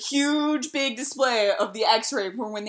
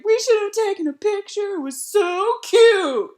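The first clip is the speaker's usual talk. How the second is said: teasing friends; 8 semitones higher, 4 dB louder than usual. Retold or imitated. Friends teasing her about sleeping on a stranger, high-pitched gushing. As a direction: imitate friends cooing over a missed photo.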